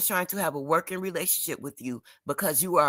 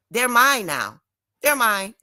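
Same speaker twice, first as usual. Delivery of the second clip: louder, higher, proud declaration; triumphant claim; 4 semitones higher, 5 dB louder, slower than usual. Real, not acted. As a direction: stake your claim loud and sure. Claiming her new friends proudly.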